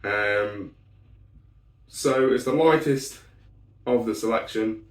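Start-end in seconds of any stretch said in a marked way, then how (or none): none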